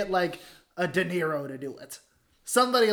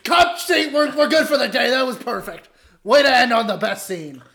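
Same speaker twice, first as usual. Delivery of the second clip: director; 5 semitones higher, 6 dB louder than usual. Acted. Plays director ending the shoot.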